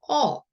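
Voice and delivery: "어" with a faint R colour, as in "er"; slight R sound. Shown as a demonstration. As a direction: hold "어" and curl it lightly towards R. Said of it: "리을 소리가 약간 있다". ("어"에 리을 소리를 약간 섞어서)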